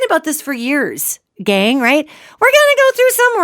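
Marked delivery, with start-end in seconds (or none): 2.4-3.4 the repeat pitched much higher and louder; emphatic high repeat. Real, not acted. Host stressing to listeners that hard times will come, pitched high and loud.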